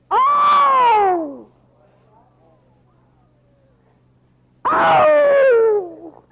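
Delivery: high, loud ecstatic cry of joy; ecstatic shout. Real, not acted. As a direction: cry out, overwhelmed by the verse. Preacher overwhelmed by verse.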